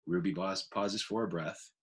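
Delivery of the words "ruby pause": ordinary delivery, nothing marked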